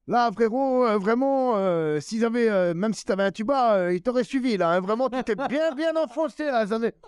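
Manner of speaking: high, put-on teasing voice with long hesitations; exaggerated high mock-sincere critique; Edgar Rive. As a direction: imitate a colleague ribbing you, pitched right up. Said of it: "son thème de voix".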